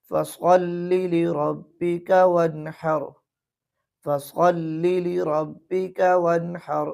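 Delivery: slow chanted recitation; slow tajweed chanting; Quranic recitation. Shown as a demonstration. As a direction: recite the verse slowly and melodically. Recites the next verse.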